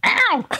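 high, sudden imitated cry; imitated yowl. Imitating Richard's answer, high yowl. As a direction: yelp it high as a quick imitation. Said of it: "And he went".

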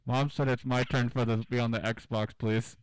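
loud, whining demand for a turn; whiny kid. Pretend. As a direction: complain loudly like a little kid. Kid whining about Xbox turn.

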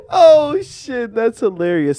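laughing. Real, not acted. Reacts to joke.

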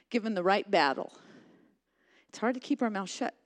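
ordinary talk

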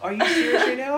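high, loud incredulous cry with a laugh; laughing in stunned disbelief; shocked disbelief. Retold or imitated. Shocked at a C grade.

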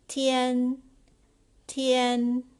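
tian said high and loud, twice; model pronunciation. Shown as a demonstration. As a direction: model the word tian for learners. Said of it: "Number nine".

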